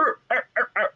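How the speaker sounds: high choppy grunts for action moves; cartoonish scuffle noises; fight sound effects. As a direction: make your own combat noises. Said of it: "I hope those sound effects conveys".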